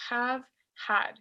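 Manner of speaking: "have, had" opened with a Spanish jota-like h. Shown as a demonstration. Teacher corrects the pronunciation for students, "have, had" with audible jota. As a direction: sound the h in "have, had" as a breath from the throat. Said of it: "se pronuncia como una J en español".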